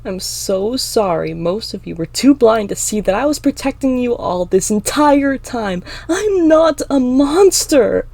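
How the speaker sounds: sarcasm rising into an anguished yell; tearful yell. A narrator's reading. Emma protests to the crowd that doubts her, tearful yell. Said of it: "I yell, choking back tears".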